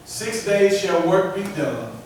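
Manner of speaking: usual speaking voice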